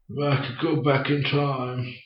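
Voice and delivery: put-on soft, slow old man's voice; wistful elderly voice, played quietly. Actor recalling his old-man role, soft and slow. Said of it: "another time being an old man".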